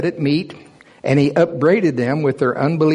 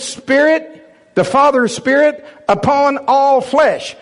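high, loud fervent preaching; preaching at full force; 7 semitones higher, 3 dB louder than usual. Acted. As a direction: preach it with authority and fire. Preaching the command to wait.

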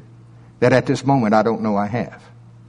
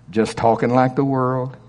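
normal talking voice

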